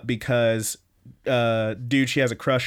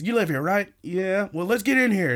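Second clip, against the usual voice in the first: higher, hammed-up pushy tone; mock pushy thug voice; 8 semitones higher than usual. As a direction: shove your way in, half-acted. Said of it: "grabs her, and says".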